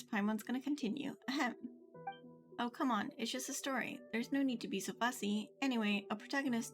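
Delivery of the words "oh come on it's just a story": usual speaking voice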